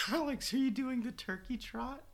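lightly voiced sister. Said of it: "Claire is like".

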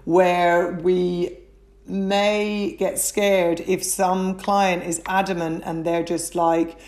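usual speaking voice

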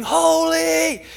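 high, loud, drawn-out cry; ringing heavenly proclamation; shouting. Acted. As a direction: shout it like a celestial being. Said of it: "And they're shouting".

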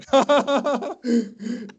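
long high laughing fit; helpless high giggling. Real, not acted. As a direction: crack up at your own joke. Laughing at self-deprecating joke.

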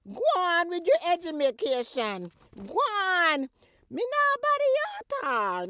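high, loud, fed-up dismissal; indignant high scolding. Acted. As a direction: wave her off in outrage. Mother dismissing daughter.

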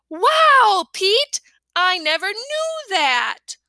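high, loud wow then slow wonder; a small boy truly impressed; wide-eyed little brother; amazed kid. A narrator's reading. Fudge amazed.

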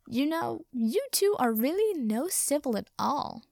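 high, swooping, lilting reproach; chiding wizard. A narrator's reading. Wizard lectures his two captives on manners, lilting chiding. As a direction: scold them in an airy singsong. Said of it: "he informed them".

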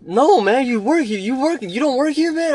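high, loud puzzled customer question; customer's voice. Retold or imitated. Confused customer asking if he works there, high and loud.

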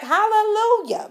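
high, loud praise shout; hammed-up shout of praise, long and soaring; rejoicing congregation. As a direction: imitate a fired-up church crowd. Imitates disciples rejoicing at the sermon.

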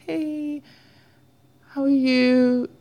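high, drawn-out, faux-casual greeting; pretending to be fine. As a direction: put on a high little voice to hide the crying. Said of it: "I just kind of pretend".

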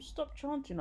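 high-pitched firm command; ordering. A narrator's reading. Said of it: "I ordered".

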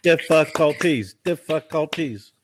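Difficulties said slowly, each syllable separated and stressed; syllables clapped out. Shown as a demonstration. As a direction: break Difficulties into its beats, slowly. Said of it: "Clap it out".

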